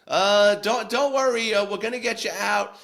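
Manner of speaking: loud, high reassurance called into the shaft; calling reassurance. Retold or imitated. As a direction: shout comfort to people below.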